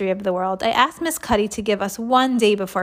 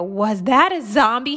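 slow, mildly shouted question; Mister Klutz shouting; 3 semitones higher, 4 dB louder than usual. A narrator's reading. Man running into the studio, shouting mildly. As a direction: draw it out in mild alarm. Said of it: "he shouted".

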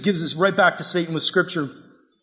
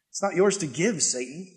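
low and much softer, ending on a lift; quiet rebuke; 3 semitones lower, 6 dB quieter than usual. Real, not acted. Preacher quietly answering Satan's temptation, low and soft. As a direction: say it hushed and firm, almost to yourself.

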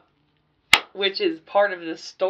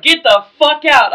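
angry yelling; 9 semitones higher, 15 dB louder than usual. Retold or imitated. Throwing an underage guest out.

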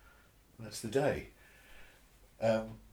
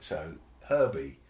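normal talking voice

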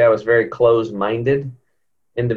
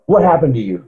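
a mock alarmed, higher, louder question; retold freaked-out question; 8 semitones higher, 5 dB louder than usual. Masseuse startled by her client's change, mock alarmed. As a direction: act out her sudden alarm at seeing him. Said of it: "like, she's freaking out".

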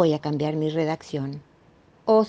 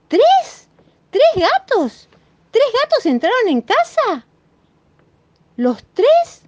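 much higher and louder, slow stunned questions; astonished child; 6 dB louder, slower than usual. Acted. Marina learning three cats took her pacifiers, astonished. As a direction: squeal each question in disbelief.

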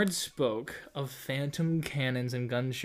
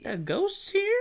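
squeaky ghost question. Pretend. Mock spooky ghost question.

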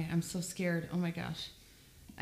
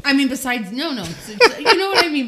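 flustered laughing; 8 semitones higher, 12 dB louder than usual. Real, not acted.

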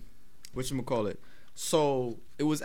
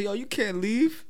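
higher-pitched, drawn-out mock objection; retold protest; 6 semitones higher, slower than usual. Coworker telling him he can't go, mock protest. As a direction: mimic a coworker objecting, dragging it out.

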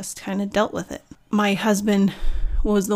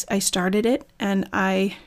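ordinary delivery

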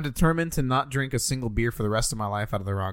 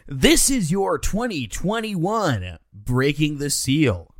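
loud, higher, drawn-out segment announcement; announcer intro; 5 semitones higher, 5 dB louder, much slower than usual. Pretend. Podcast host opens the beer segment, grand announcer voice. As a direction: announce it big and slow.

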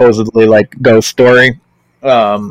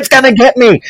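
put-on scared little girl; 12 semitones higher than usual. Scared child voiced by parent.

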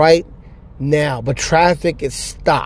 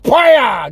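a loud, higher mouth-made gunshot "Baya!"; mock shotgun blast; 9 semitones higher, 3 dB louder than usual. Old woman firing into a coffin, a loud mock gunshot. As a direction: fire the imagined shotgun with your voice.

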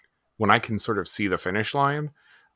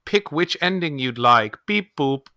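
put-on high, loud childlike hologram voice; chirpy kid hologram, robotic beep; little boy hologram voice; 7 semitones higher, 6 dB louder than usual. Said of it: "and it's like".

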